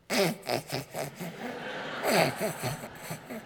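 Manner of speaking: soft, stifled snicker in bursts; imitated kids' snickering. Kids overhearing Dad from the next room, snickering. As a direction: mimic children trying to hold in their laughter. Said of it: "I hear from the next room over".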